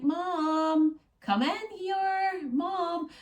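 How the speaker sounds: put-on high, slow little-girl call; toddler calling mum. Toddler calling mum into her room, in a high slow voice. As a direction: drawl it like a child calling from her bed.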